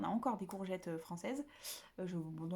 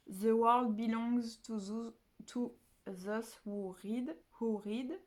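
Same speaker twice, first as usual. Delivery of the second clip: slow French-accented English, carefully read; 2 semitones higher, much slower than usual. Shown as a demonstration. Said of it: "J'ai un accent anglais absolument dégueulasse".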